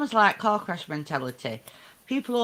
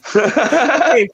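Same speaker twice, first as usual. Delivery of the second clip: laughing shout; 7 semitones higher, 11 dB louder than usual. Real, not acted.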